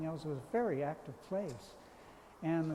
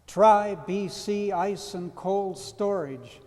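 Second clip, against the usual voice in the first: louder, higher and drawn out; bright sign slogan; 4 semitones higher, 11 dB louder, much slower than usual. A narrator's reading. Poet quoting the ice plant's sign in a poem, brightly. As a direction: read the slogan out brightly and slowly. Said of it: "a sign says brightly".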